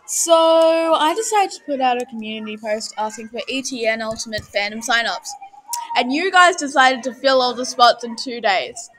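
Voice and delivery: excited. Real, not acted. Hyped intro greeting viewers.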